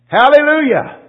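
high, loud hallelujah cry; shouted hallelujah. Real, not acted. Preacher to the congregation, shouted praise. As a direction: ring it out to the rafters.

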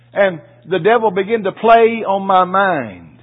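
loud, slow, building preacher line. Real, not acted. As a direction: lean into it slowly and loudly.

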